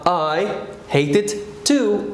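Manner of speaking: slow, careful model line; teacher models the reply slowly; slow model answer. Shown as a demonstration. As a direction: say it slowly for learners. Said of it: "So you say".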